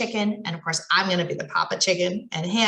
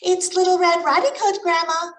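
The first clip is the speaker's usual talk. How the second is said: high, louder sugary fake girl voice; wolf poses sweetly as the girl; sweet as honey; 9 semitones higher, 4 dB louder than usual. Wolf fakes a sweet girl voice at grandma's door. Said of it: "in a voice as sweet as honey".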